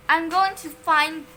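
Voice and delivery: loud, slow menacing teacher; growl. A narrator's reading. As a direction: growl each word slowly. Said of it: "she growled".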